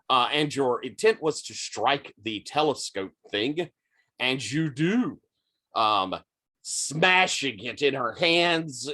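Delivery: ordinary delivery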